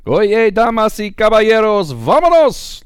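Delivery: high booming ring announcer shout; lucha libre announcer. Acted. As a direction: roar it like a wrestling ring announcer.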